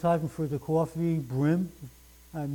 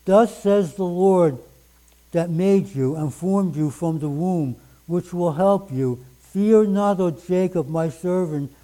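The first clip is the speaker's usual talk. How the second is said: louder, solemn proclaiming of the verse; booming King James reading; 5 dB louder than usual. Acted. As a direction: declaim the old scripture at full force. Reads King James verses aloud.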